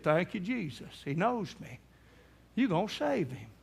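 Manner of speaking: soft, quiet gratitude; hushed thanks. Retold or imitated. Grandson thanks Jesus quietly at the bedside, hushed. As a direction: breathe it out in awe.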